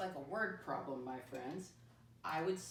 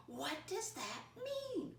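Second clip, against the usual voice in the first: mock puzzled kid; 10 semitones higher, much slower than usual. Teacher voices kids' question.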